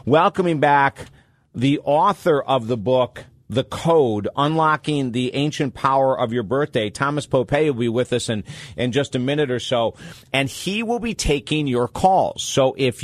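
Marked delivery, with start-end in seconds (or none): none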